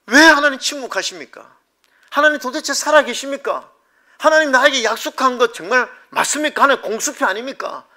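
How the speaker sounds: loud, high, impassioned plea; acted outcry of a struggling believer; protesting to God.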